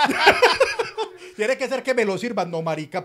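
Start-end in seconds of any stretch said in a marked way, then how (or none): 0.3-1.3 sudden high burst of laughter; loud laugh. Real, not acted. Friend laughing hard at the price, a loud high laugh. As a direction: crack up loudly at the joke.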